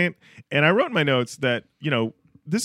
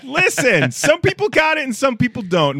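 loud; 10 semitones higher, 6 dB louder than usual. Real, not acted. Loud emphatic declaration.

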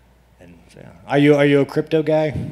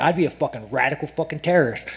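normal talking voice

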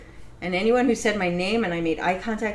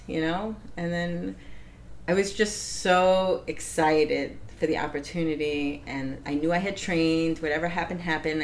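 ordinary delivery

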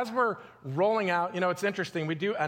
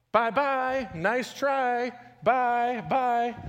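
mock the losing side with a gloating farewell; taunting bye-bye; 7 semitones higher, much slower than usual. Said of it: "he's like".

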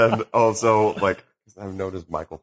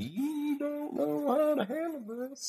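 flustered; 8 dB quieter, slower than usual. Retold or imitated. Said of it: "it was just like".

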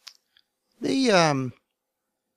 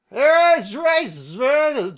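high, loud, slow drawn-out mock shout; drunk man screaming; 12 semitones higher, 7 dB louder, much slower than usual. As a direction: bellow it like a drunk across the street. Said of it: "something along the lines of this is".

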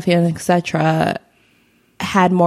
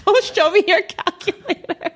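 laughing; 12 semitones higher than usual. Real, not acted. Laughing at the calculator joke.

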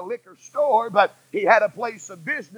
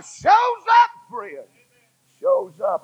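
high exclaim; 13 semitones higher, 3 dB louder, slower than usual. Acted. Preacher exclaiming at full force.

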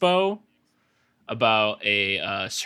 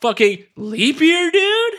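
loud, high, drawn-out mock excitement; over-the-top rising holler; hyped-up outburst; 8 dB louder, slower than usual. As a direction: belt it out like a hyped dude. Mock-hyped outburst about leap year.

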